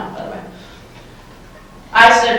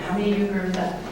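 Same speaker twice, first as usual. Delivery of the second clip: softer second ask; 9 dB quieter, much faster than usual. Shown as a demonstration. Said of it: "did you notice the difference in the two answers".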